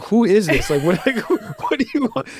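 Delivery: high-pitched mock bewilderment, breaking into laughter; baffled inner voice. Musician retelling his thoughts on a mystery call, baffled and laughing. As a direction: play up the puzzled disbelief with a laugh.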